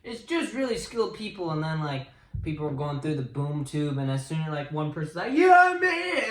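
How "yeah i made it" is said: high, loud acted cheer; hammed-up cry of victory; mock triumphant shout. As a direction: yell it like a winner. Acting out person cheering escape.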